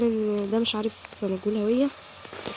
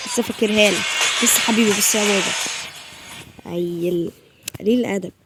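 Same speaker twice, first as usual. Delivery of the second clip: loud, cocky warning; real teasing threat; loud taunt; 5 dB louder than usual. Real, not acted. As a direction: threaten him with a grin. Taunting the character.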